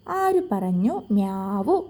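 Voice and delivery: slow, low playful kitten voice; drawn-out meow like a kitten; cat meow. Acted. Storyteller to children, a slow mock meow.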